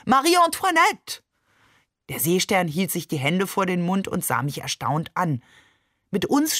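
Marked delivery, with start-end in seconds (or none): 0.1-1.2 high, hammed-up French cry; theatrical call. A narrator's reading. Barbe chasing Maman out, calling theatrically. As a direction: cry the name out grandly, pitched right up. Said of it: "rief Barbe theatralisch".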